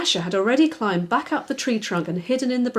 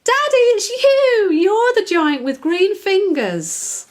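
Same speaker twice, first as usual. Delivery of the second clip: put-on much higher and louder, slow, delighted child's shout; excited shout; 11 semitones higher, 7 dB louder, slower than usual. Daisy realising the giant is Daddy, shouting excitedly. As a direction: burst out with joy at the discovery. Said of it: "shouted excitedly".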